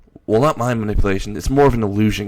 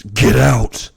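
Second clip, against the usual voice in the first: loud, forceful narrated yell; raised-voice outburst from a father; 6 dB louder than usual. A narrator's reading. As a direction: yell the order in one burst. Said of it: "And then he yells".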